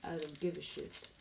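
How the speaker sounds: low, soft echo of a film monologue; understated movie-line mimicry; Morgan Freeman. Echoing Morgan Freeman's film lines.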